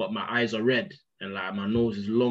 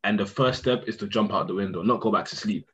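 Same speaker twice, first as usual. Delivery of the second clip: ordinary talk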